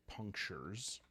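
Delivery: ordinary talk, nothing marked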